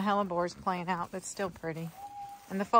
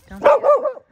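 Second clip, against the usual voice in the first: pet-talk scold; 11 dB louder than usual. Real, not acted.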